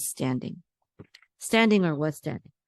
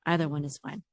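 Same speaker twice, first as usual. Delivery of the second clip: ordinary talk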